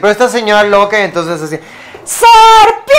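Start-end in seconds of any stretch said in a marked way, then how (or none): 2.0-3.0 piercing high shriek; shrieked serpent. Acted. Screaming woman shrieks at the snake in her house. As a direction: scream it out in sudden fright.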